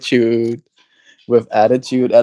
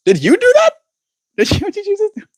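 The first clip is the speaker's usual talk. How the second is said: high, astonished disbelief; astonished squeal; faster than usual. Real, not acted. Streamer squeals at the viewer who sponsored sixteen months, astonished. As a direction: squeal it out, can't believe it.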